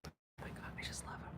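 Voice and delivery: whispered love; whispered affection for a dog figurine; adoring whisper. Real, not acted. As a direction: breathe it out, smitten.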